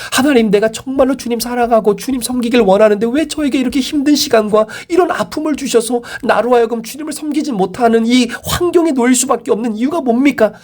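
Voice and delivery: high-pitched, urgent pleading complaint; acted lament to God. Struggling believer questioning God about hardship, high pleading lament. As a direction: pour out a grievance in a sustained high voice. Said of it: "그렇게 하나님 앞에 하소연하겠습니까".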